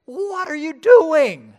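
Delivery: Paul yelling what are you doing; incredulous shout. Retold or imitated. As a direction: bellow it as if shocked at the violence. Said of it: "And Paul is just like".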